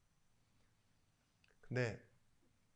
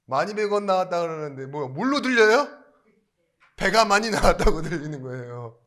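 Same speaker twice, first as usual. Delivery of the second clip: much louder and higher, exclaiming; loud, incredulous; 5 semitones higher, 15 dB louder than usual. Real, not acted. Preacher asking listeners what they hear, loud exclamation. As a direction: belt out the punchline to the crowd. Preacher drives the punchline home.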